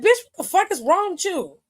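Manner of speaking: irritated retort. Retold or imitated. Snapping back at someone who angered the speaker today, irritated retort. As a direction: retell it as an exasperated challenge. Retells own angry confrontation.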